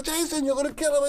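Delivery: high, fast alarmed yell; alarmed cry. Retold or imitated. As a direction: warn them in a rush. Retold alarmed shout.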